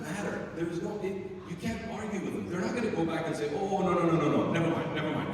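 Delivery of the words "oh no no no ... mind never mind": loud, rushed mock retraction; sarcastic flurry of second thoughts; mock backtracking. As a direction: pretend to hastily take it all back. Mock referee backing down on a call.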